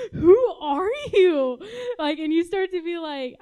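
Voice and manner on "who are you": louder, higher, slow laughing question; laughing disbelief. Retold or imitated. Friend amazed by someone's joy, laughing.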